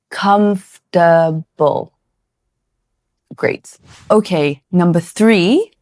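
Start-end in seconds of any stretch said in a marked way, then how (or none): 0.1-1.9 comfortable drawn out slowly as KUMF-tuh-bul; a slow, careful comfortable to repeat; slow model word. Shown as a demonstration. Teacher slowly models comfortable for students. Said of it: "try it again with me".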